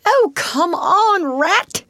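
high, loud, drawn-out whine; impatient call. A narrator's reading. Mole waiting while rat digs, impatient call. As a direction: call out slowly in exasperation. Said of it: "waited impatiently".

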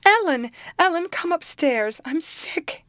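put-on girl calling her nurse upstairs in panic; frantic call. A narrator's reading. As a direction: cry out for help in alarm. Girl calls nurse in alarm.

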